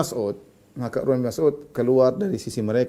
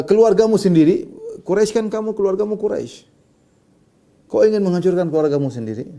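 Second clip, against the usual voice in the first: retold reproach in a raised voice; mildly heated retold rebuke; harsh accusation; 5 semitones higher, 3 dB louder than usual. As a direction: press the accusation firmly. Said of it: "Perkataannya keras".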